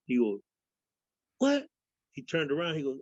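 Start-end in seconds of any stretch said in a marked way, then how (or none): 1.4-1.7 higher, sharp mock surprise; retold surprised what. Retelling a guard's reaction on the bus, in raised mock surprise. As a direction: quote the guy's startled reaction.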